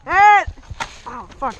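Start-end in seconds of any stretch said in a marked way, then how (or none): 0.1-0.4 sudden loud yell; man down shout. Real, not acted. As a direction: yell out when hit. Hit, calling out in game.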